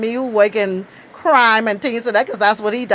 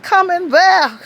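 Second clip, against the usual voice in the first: pitched up in disbelief, a bit louder; mock-shocked come in where; incredulous retort; 9 semitones higher, 3 dB louder, slower than usual. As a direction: toss it back like she can't believe it. Incredulous retort to FBI agent.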